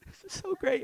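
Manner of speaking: very high, loud mock-weepy squeal; imitating bawling. Mimicking the team bawling over the miracle, mock tearful squeal. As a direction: squeak it out like a happy sobber.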